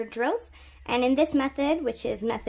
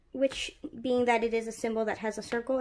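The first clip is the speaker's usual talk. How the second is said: ordinary talk, nothing marked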